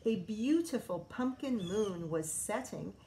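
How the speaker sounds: usual speaking voice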